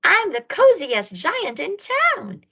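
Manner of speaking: high, joyful proclamation; triumphantly cozy at last; happy cry. A narrator's reading. George dressed in his gown again, crying out joyfully. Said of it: "he cried".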